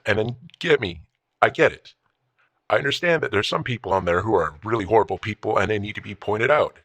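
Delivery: usual speaking voice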